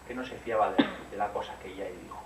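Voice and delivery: usual speaking voice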